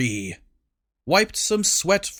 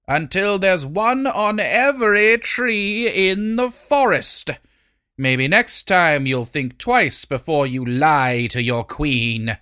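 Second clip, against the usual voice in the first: imperious queen; 3 semitones higher, 3 dB louder than usual. Acted. Queen scolding servant.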